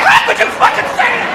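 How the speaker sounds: high, airy outraged voice; outraged reply. Retold or imitated. Angry poster replying to the comic, high airy outrage. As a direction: squeak out the outrage. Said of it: "you just can get 'em going".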